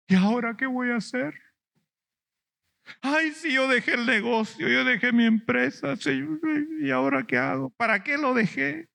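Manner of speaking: high whiny mock lament; a hammed-up self-pitying complaint, high; lamenting disciple. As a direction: wail like a man who lost everything.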